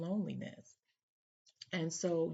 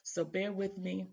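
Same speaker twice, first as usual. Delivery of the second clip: ordinary talk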